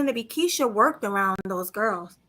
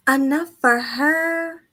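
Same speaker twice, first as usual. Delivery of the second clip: slow, drawn-out skeptical repeat; incredulous echo; 5 semitones higher, 4 dB louder, slower than usual. Real, not acted. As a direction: echo it back like you can't believe the question. Incredulous echo of partner's question.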